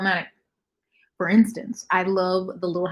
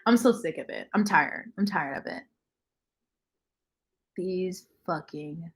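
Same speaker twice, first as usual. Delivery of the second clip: ordinary delivery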